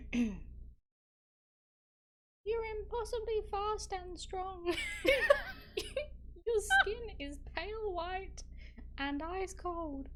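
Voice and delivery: high, slow melodramatic heroine; dramatic reading. Acted. Streamer reading Twilight to viewers, high melodramatic heroine. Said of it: "that is a dramatic reading".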